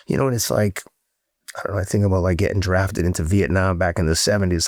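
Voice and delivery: ordinary delivery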